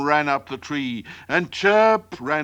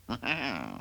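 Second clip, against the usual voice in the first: soft laugh; 2 semitones lower, 12 dB quieter than usual. Real, not acted. After the joke.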